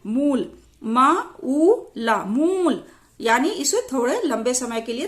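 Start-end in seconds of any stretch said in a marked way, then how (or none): 0.0-2.2 मूल with a long oo, then slowly sounded out; drawn-out vowel. Shown as a demonstration. Teacher spelling a long oo word, slow stretched मूल. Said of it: "बोलते हैं खींचकर". 2.2-2.8 मूल with a long held oo; drawn-out vowel. Shown as a demonstration. Teacher showing long oo matra, मूल drawn out. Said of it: "बोलते हैं खींचकर".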